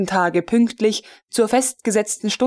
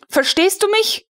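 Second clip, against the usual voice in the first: much higher, pointed threat; menacing question; 11 semitones higher than usual. A narrator's reading. Colonel holds the cane under his nose, menacing and higher. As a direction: make him understand the cane.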